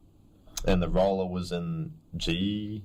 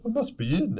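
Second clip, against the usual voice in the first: high mocking voice, much higher; mock clueless; 14 semitones higher than usual. Mocking his mate's clueless words, high-pitched. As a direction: squeak it out like a clueless mate. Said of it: "and then you're like".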